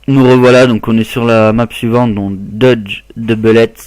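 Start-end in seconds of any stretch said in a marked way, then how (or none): 2.4-3.7 slow, deliberate English accent; drawn-out title in a French speaker's English accent; super English accent. Shown as a demonstration. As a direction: lay on an English accent, word by word. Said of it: "avec mon super accent anglais".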